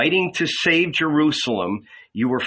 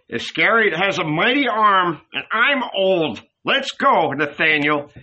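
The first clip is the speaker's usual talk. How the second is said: put-on higher-pitched timid old man; Philip; 6 semitones higher than usual. A narrator's reading. Old man urging his friend to leave, timid high voice. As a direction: plead old age and back out, high.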